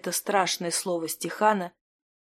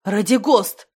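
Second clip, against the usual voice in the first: loud, groaned name; wavering, pained call; moaning; 2 semitones higher, 7 dB louder, slower than usual. A narrator's reading. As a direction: moan the name in protest. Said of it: "стонал за спиной Леонорий".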